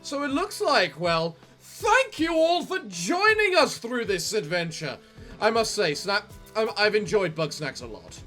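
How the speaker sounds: ordinary delivery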